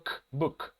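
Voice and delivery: "K, book" with the K isolated and then closing the word; final k sound. Shown as a demonstration. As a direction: show the K, then "book" ending on it. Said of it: "el sonido final es el sonido de la K".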